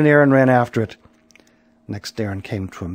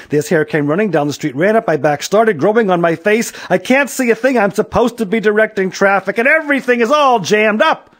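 frantic complaint climbing higher and louder; exasperated rant; 9 semitones higher, 5 dB louder than usual. A narrator's reading. Policeman who can't direct traffic, exasperated rant. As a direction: build the complaint to a high pitch.

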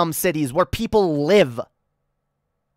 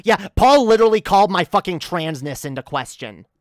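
ordinary talk, nothing marked

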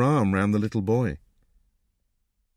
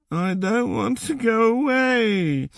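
put-on high, slow tearful little boy; drawn-out, high-pitched childish whine; tearful sniffing; 11 semitones higher, slower than usual. A narrator's reading. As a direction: wail it slowly like a crying child. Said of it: "sniffed the boy".

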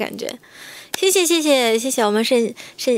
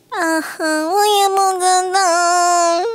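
put-on high, slow girly coo; cutesy falsetto voice; 7 semitones higher, much slower than usual. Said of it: "又夹又rap那种".

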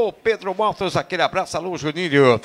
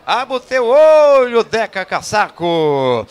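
loud drawn-out shout; 7 dB louder, slower than usual. Real, not acted. Shout-out to a listener.

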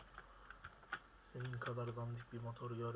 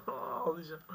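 laughing mock whine; 6 semitones higher, 4 dB louder, slower than usual. Real, not acted. Stuck level, laughing.

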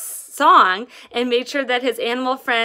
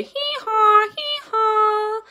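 high braying hee-haw; donkey bray; 11 semitones higher, 4 dB louder, much slower than usual. Acted. Donkey answering the fly, high bray. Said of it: "began to bray".

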